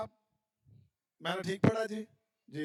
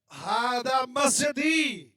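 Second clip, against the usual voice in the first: stretched-out, louder recited answer; slow pupil answer; 5 semitones higher, 6 dB louder, much slower than usual. Pretend. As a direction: recite it slowly like an unsure pupil. Teacher acting a student's answer.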